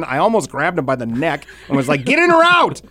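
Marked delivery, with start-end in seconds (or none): 2.0-2.8 high, louder exasperated yell; angry shout. Retold or imitated. Yelling at the kids frozen in the doorway, angry shout. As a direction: snap it at the kids blocking the door.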